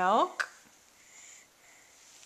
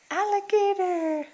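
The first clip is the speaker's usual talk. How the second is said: drawn-out excited naming; 7 semitones higher, 5 dB louder than usual. Acted. Naming toy animals with toddler.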